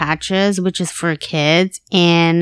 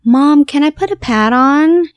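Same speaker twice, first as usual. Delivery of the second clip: put-on higher, louder little-girl voice; imitating young daughter; 7 semitones higher, 5 dB louder than usual. Sick daughter asking her mom for the patch, imitated child voice. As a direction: ask like an eager child, pitched up. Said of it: "first thing she says".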